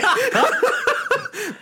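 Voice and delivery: sudden high laughing burst; high burst of laughter. Real, not acted. Telling a friend about meeting [name], squealing laugh.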